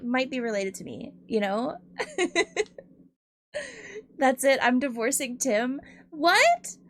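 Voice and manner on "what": high, loud outcry; shocked protest. Real, not acted. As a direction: yelp it in shocked alarm. Streamer protests mock divorce.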